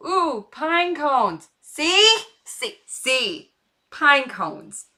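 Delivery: high, loud burst of delight; suddenly sidetracked and thrilled; distracted excitement. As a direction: blurt it out like you just spotted treasure. Gets distracted by pine cones.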